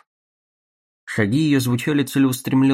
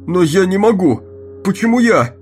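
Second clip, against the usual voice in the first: panicked cry; 8 semitones higher, 6 dB louder than usual. Acted. Guard cries out.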